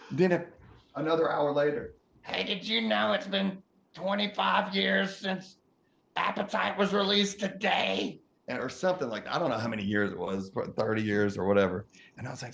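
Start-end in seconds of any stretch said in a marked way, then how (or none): 2.2-8.2 higher, whiny impatient rock star; whiny Steven. Retold or imitated. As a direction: complain like a restless passenger, higher. Voicing Steven again.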